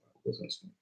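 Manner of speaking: barely voiced repeat; whispered aside. Real, not acted. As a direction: whisper it under the breath. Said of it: "I wasn't smoking at all".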